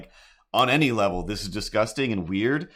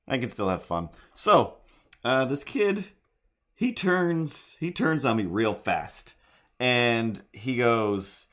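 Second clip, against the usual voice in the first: normal talking voice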